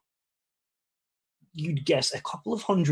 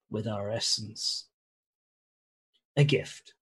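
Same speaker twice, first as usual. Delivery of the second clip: snake voice. Acted. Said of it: "the creature says".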